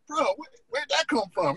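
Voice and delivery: lightly voiced surfer bro, pitched high; surprised volleyball players. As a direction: play an amazed beach guy, half mocking. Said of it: "They were like".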